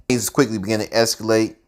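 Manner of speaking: ordinary delivery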